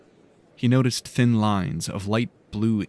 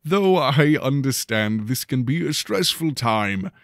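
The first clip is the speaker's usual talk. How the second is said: headmaster's address; 3 semitones higher than usual. A narrator's reading. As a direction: project it to a packed hall like a showman headmaster. Headmaster continues his speech.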